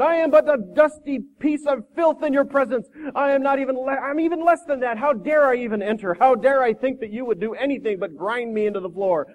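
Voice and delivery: high, abject grovelling; blubbering. Acted. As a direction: whimper self-abasement in a hammed-up wail. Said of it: "blubbered the man".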